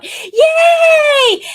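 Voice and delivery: high, very loud cheer; excited cheer. Retold or imitated. Grandmother celebrating the win with her grandson, excited cheer. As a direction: let out a big delighted whoop. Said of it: "I started going".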